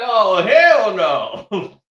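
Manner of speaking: low, slow, dragged-out refusal; drawn-out hell no. Retold or imitated. Crew hearing Kitty's in the house, slow disbelieving refusal. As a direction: stretch it out in disbelief.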